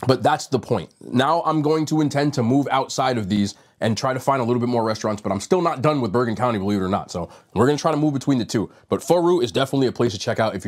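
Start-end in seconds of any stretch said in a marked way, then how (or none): none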